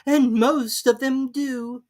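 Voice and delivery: high, slow, imperious lady; grand and dismissive; Duchess. A narrator's reading. Duchess figure voice.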